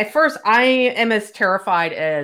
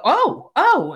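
startled yelp; 11 semitones higher, 3 dB louder than usual. Retold or imitated. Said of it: "and I go".

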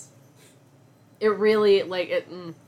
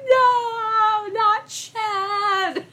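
mock wail; 12 semitones higher, 3 dB louder, much slower than usual. Fan wailing over debut lineup.

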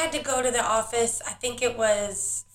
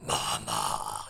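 a much lower, drawn-out Mama; deep mock mama; 10 dB quieter than usual. Mother imitating the kids' low insistent call. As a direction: drop way down for a menacing Mama. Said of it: "they'll hit me with a".

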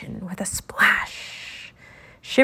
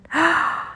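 high gasp of discovery; excited gasp; 8 semitones higher than usual. A narrator's reading. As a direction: gasp as if spotting just the thing. Genie spots the right star.

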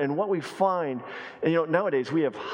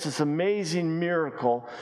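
normal talking voice

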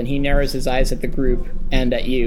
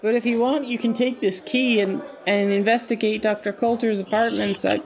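higher, lighter character voice; NPC voice; 8 semitones higher than usual. Acted. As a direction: play the nervous NPC, quickly. NPC offering the key.

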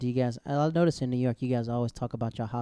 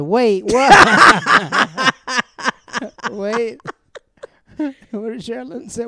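higher and louder, laughing through "Wait, what?"; laughing disbelief; 10 semitones higher, 12 dB louder, much slower than usual. Retold or imitated. Imitating the whole family hearing the news, laughing disbelief. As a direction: burst out in laughing shock. Family reacts to surprise announcement.

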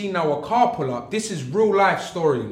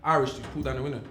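usual speaking voice

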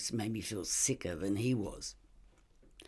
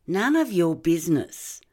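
louder, higher, curt shutdown; defiant refusal; 7 semitones higher, 8 dB louder than usual. Acted. Suspect shuts down the detective's probing, defiant and loud. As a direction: slam the door on the question.

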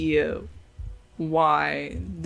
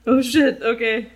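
loud startled outburst, higher; surprised; 5 semitones higher, 9 dB louder than usual. Real, not acted. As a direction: blurt it out in shock. Learns bond lasts an hour.

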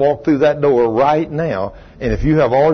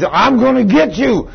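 high, slow menacing threat; preacher voicing the woman lunging at him; possessed woman; 7 semitones higher, 3 dB louder, slower than usual. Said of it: "And she's".

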